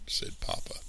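ordinary delivery, nothing marked

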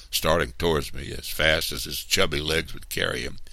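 ordinary delivery, nothing marked

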